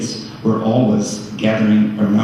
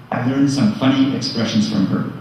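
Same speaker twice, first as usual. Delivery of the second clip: ordinary talk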